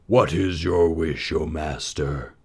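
slow booming genie; a grand servile genie. A narrator's reading. Genie answers the lamp.

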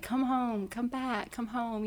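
soft, gentle come home; tender calling. Retold or imitated. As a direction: call softly like a welcome. God calling her home.

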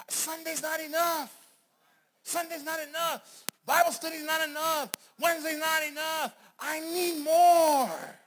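high, loud, drawn-out preacher's cry; slow, high shouts building to a long wail; impassioned shout. Acted. Acted cry of the dissatisfied worshipper.

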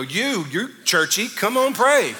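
slow then rising to a loud, high call; coworkers' eager nagging. Retold or imitated. Coworkers at a potluck pushing the pastor to pray, loud and eager.

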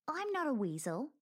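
icy put-down, leaning on not; a ferret coldly correcting the wolf; cold retort. Acted. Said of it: "Squeak said coldly".

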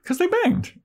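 high, emphatic repeat of the punchline; squealed emphasis on banged; excited emphasis. Real, not acted. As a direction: blurt it out high with glee. Repeating for emphasis, big pitch jump.

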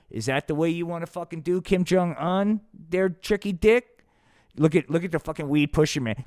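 normal talking voice

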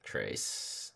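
"Trace" whispered with a long ay vowel; whispered correct word. Shown as a demonstration. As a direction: say "Trace" softly like tray-s. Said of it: "Vanished without a trace".

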